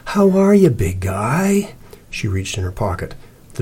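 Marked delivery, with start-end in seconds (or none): 0.1-1.7 high, sing-song coo to a big dog; soothing. A narrator's reading. Cece greeting the growling dog, soothing. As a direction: sweet-talk the dog, starting high and loud. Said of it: "in a soothing voice".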